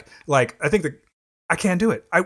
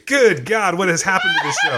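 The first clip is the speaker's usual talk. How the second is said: laughing outburst; 3 semitones higher than usual. Real, not acted. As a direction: crack up and shout it at the ceiling. Bursting out laughing at the banter.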